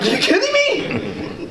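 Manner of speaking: high, slow, laughing are you kidding me; stunned, giggling disbelief at the strike. Retold or imitated. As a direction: let it out through a laugh of amazement. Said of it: "I'm like".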